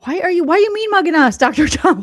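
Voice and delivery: a hammed-up startled protest; loud mock panic at the camera stare; mock alarm.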